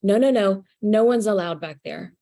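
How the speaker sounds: loud, insistent refusal; ego voice. Retold or imitated. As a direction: bark a flat no at the intruder. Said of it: "I hear the ego voice go".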